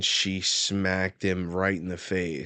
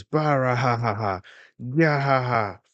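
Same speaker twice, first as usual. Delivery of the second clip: loud, higher mock belly laugh; acted booming laugh; 5 semitones higher, 5 dB louder than usual. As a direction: bellow a hammed-up kingly laugh. Acted laugh of King Harold.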